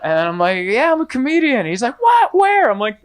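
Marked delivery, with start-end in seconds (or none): 0.7-1.7 high chirpy reply. Retold or imitated. Acted chat with Uber driver. 2.0-2.7 high, loud excited what where; excited surprise. Retold or imitated. Driver's excited surprised reaction.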